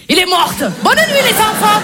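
put-on abrupt babysitter sign-off in German accent. Babysitter bidding children good night, German accent. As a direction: end the song bluntly in German-accented French. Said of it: "Humour germanique".

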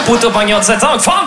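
put-on Chinese gibberish; mock Chinese. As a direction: answer in fake Chinese. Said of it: "Le chinois énervé".

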